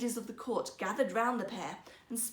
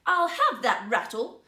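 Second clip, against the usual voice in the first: loud, slow, spoilt demand; the spoilt princess insists on having it; imperious demand; 10 dB louder than usual. Acted. Princess to her maids, a slow loud spoilt demand.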